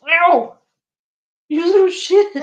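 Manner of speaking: sudden high, loud yelp of pain; a bitten owner yelps at the cat, loud and high; real pained yelp. Real, not acted. Cat bites her hard.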